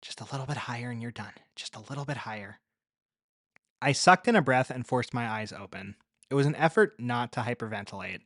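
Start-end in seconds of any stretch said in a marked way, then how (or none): 0.0-2.6 fast, soft repeated mantra; quiet mantra. A narrator's reading. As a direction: murmur it to yourself, hurried. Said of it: "repeating her two sentences like a mantra in my head".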